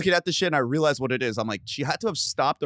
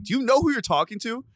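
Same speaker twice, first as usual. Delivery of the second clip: high-pitched indignant question; incredulous retort; 11 semitones higher than usual. Retold or imitated. Telling off a creepy stranger on the phone, incredulous. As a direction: pitch it up in disbelief. Retold reply to creepy threatening caller.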